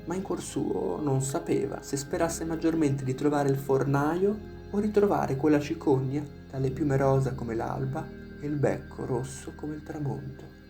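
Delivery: plain reading voice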